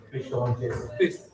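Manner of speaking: ordinary delivery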